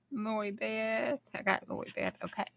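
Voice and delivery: ordinary talk, nothing marked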